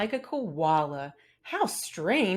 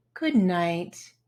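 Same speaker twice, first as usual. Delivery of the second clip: calm fairy voice; 3 semitones lower, much slower than usual. Acted. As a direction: lull them to sleep gently. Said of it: "It would be so calm".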